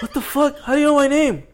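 high-pitched mock bewilderment; startled customer. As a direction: imitate a customer taken aback. Voicing startled customer in story.

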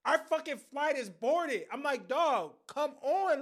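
loud, high exasperated complaint. Retold or imitated. Nephew yelling at his uncle to hurry, loud and high. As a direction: throw your hands up at him, loud and high.

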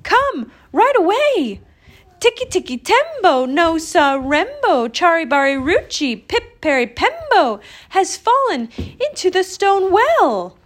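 put-on high, loud, urgent child's yell; shouting. A narrator's reading. Chang summons old man to the well, shouting. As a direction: keep shouting for help, pitched high. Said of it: "shouted Chang".

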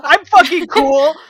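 loud, high, slow mock boast; strained show of coolness, played for laughs; overcompensating bravado. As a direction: shout it to convince yourself. Retold overeager bluff to friend.

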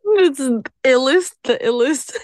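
laughing through the words. Real, not acted. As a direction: giggle while calling yourself ill. Guest laughing about being ill.

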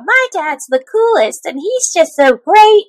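a hint of a gushing little kid; kid's voice. Mocking the Fuhrer's son's essay, lightly childish. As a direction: read it like a kindergartner's essay. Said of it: "writing his kindergarten essay about".

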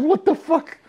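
high, loud stunned curse; shocked outrage. Retold or imitated. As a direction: blurt it in disbelief. Reacting to girl's racist excuse.

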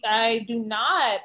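normal talking voice

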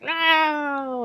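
long, high drawn-out cry; hammed-up cry of defeat; mock villain wail. Villain locked in cell, retold.

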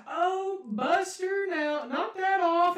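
high, slow put-on lady voice; loud singsong mock scolding of a pet; dog owner imitation. Neighbour mildly telling off her dog, high mock lady voice. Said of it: "she's just like".

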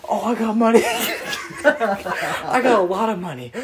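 giggly boast, slowing down. Retold or imitated. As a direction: brag through laughter. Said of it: "And Taylor's like".

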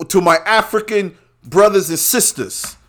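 mock Fauci with a slightly put-on voice; lightly voiced Fauci addressing his African brothers and sisters. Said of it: "At least he said it right".

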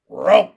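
loud booming frog call; frog song call. Acted. Pin singing proudly to his friend, loud booming frog call. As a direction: boom it out like a bullfrog. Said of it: "he sang".

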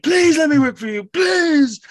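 very high, loud pleading squeal; passionate mock begging. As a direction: beg shrilly, hammed-up and desperate. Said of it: "talking about it passionately".

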